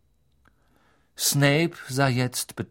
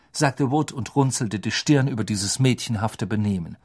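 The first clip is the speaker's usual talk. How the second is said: ordinary delivery, nothing marked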